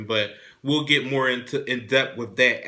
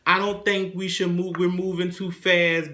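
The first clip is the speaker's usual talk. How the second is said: lightly mimicked coy protest, higher pitch; playing reluctant; 5 semitones higher than usual. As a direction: put on a higher voice for a mock "oh no". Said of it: "Erica's playing".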